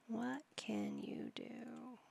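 slow, unvoiced whisper. Real, not acted. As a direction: breathe the question out as a slow whisper. Repeated question to Sim.

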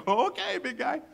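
mock humouring. Studio humours Lucas.

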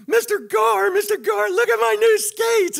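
put-on high, loud, excited child voice; little girl shouting with joy over new skates. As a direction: squeal it like a thrilled little kid.

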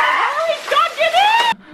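high frantic yell, slowing on get in; urgent shout. Retold or imitated. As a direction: call out in a panic. Urgent call during hailstorm.

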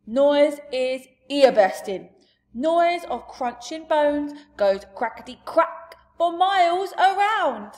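a big friendly giant holding forth; giant's loud booming talk. Acted. Giant voice for long speech.

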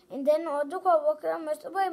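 plain reading voice

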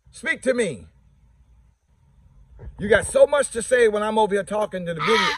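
high, loud calling to the birds; high-pitched calling the flock; high coaxing call. Real, not acted. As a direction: call the ducks over to eat. Calling ducks to come eat.

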